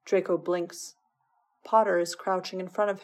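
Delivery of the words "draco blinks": plain reading voice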